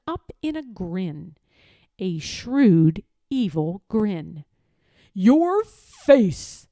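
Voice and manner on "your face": louder villain voice, stressing your; villain's taunt. Acted. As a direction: hook him with it, lord's sneer. Villain lord confronts boy.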